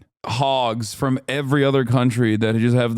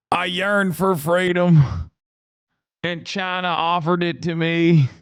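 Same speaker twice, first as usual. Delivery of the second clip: a grifter's earnest American pitch, laid on thick; thick American accent; 6 semitones higher than usual. Retold or imitated. Mock defector speech in a thick American accent. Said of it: "a thick American accent".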